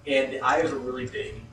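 normal talking voice

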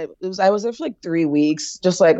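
ordinary delivery

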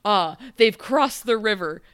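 lightly hammed-up prince; mock heroic prince. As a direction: announce it with mock gravitas. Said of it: "Henry's like".

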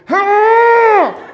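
"Ah!" as a sharp, high pained yell from the next room. Retold or imitated. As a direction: mimic a massage victim crying out. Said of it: "the person in the other room going".

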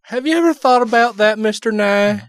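hammed-up bumpkin, pitched well up. Co-host plays the hick heckler.

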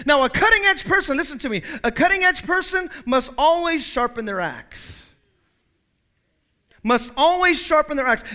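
loud, high preacher's call; preaching. Acted. Pastor to the church, preaching at full force. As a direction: preach it out to the congregation.

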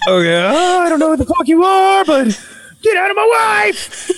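high, strained yell at a spirit; mock panicked shout. Husband ordering a spirit out of his wife, high yell. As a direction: cry it out high, hammed-up. Retold confronting the possessing spirit.

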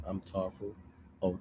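usual speaking voice

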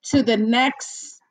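usual speaking voice

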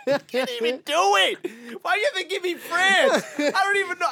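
high, loud complaint through laughter; amused protest, loud and high; laughing exasperation. Real, not acted. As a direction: whine it while cracking up. Protests being given France, laughing.

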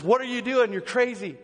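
high, shocked recoil; startled outburst. Retold or imitated. Prisoner recoiling from the pastor's hug, shocked and high. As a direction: blurt it out high in alarm.

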